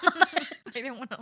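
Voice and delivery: laughing loudly, embarrassed. Real, not acted. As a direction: laugh it out sheepishly.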